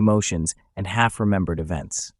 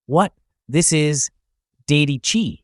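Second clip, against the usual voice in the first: raised, halting voice of dawning shock; startled realization; 6 semitones higher, 4 dB louder, slower than usual. A narrator's reading. Mugang realizes what energy is entering him, startled.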